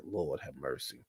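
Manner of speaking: soft, slow, weary sigh of a prayer; hushed Lord have mercy. Retold or imitated. Telling friends about the fight, a hushed Lord have mercy. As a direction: breathe it out under your breath. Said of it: "And I was like".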